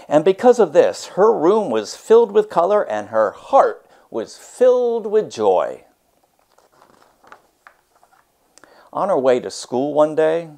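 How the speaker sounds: lively read-aloud storytelling voice; story narration. A narrator's reading. Teacher reads a picture book to her class, expressive read-aloud.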